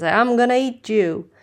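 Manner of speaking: the troll threatening the little goat, loud and menacing. A narrator's reading. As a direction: growl it out like a troll under the bridge. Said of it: "and the troll says".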